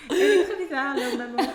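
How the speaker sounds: cracking up mid-sentence; laughing. Real, not acted. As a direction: laugh out loud at the gossip. Laughing about the mother.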